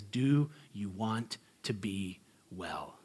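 slow, soft, low question; a quiet tender Jesus; gentle Jesus. Retold or imitated. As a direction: ask it gently and slowly.

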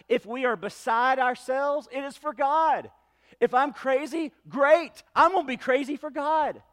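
defiant declaration. Acted. Paul owning the crazy for God, defiant declaration. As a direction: proclaim it high and emphatic, owning it.

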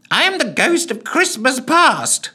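high booming ghost voice; ghostly bellow. A narrator's reading. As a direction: bellow like a hammy phantom. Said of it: "he bellowed at Scrooge".

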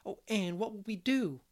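put-on girlish voice of alarm; dismayed exclaim. A narrator's reading. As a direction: Diana wailing what to do. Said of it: "she exclaimed in dismay".

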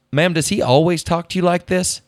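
lightly voiced concerned-cop question, pitched high; high, retold officer voice; police officer. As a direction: play an officer addressing the wife. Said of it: "And the officer goes".

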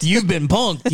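high-pitched mock reveal; Ashton Kutcher. Ashton jumping out to end the hoax, mock reveal. Acts prank show reveal.

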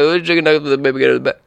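very fast, run-together words; garbled rushed order. Acted. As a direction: race through it as the mumbling customer. Acted customer rattles order off unintelligibly.

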